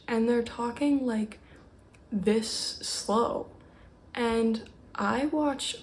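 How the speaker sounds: slow, drawn-out words with pauses; deliberately slow. Shown as a demonstration. Fast talker showing how slow YouTubers talk, deliberately dragging. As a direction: drag each phrase out to demonstrate slow talking. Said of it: "I was just trying to talk slow".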